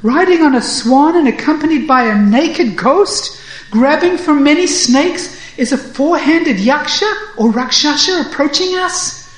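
high, loud, alarmed warning; frightened. A narrator's reading. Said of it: "frightened, looks up and says".

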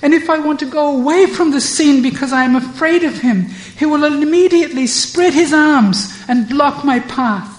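high, loud, fast indignant protest; angry. Acted. Radha protesting angrily to Purnamasi, high and loud. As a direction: plead and protest hotly, pitched high. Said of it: "Radha angrily says".

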